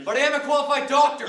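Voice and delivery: loud, high-pitched insistence; indignant protest. Acted. Former student defending his qualification, indignant and loud. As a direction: insist on your standing forcefully.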